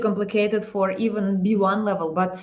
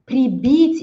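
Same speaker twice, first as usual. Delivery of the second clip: normal talking voice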